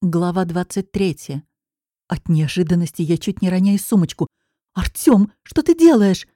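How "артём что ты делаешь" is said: startled cry, pitch leaping up and louder; shocked, she calls out his name and asks; surprised exclamation. Acted. Girl startled when her trainer grabs her hand, exclaiming. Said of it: "восклицаю я".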